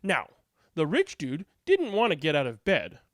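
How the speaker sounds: usual speaking voice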